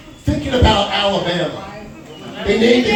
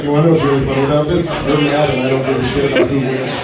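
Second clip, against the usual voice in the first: rapid retort; 5 semitones lower, much faster than usual. Retold or imitated. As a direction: rattle it off at top speed. Rapid-fire retort to the jock.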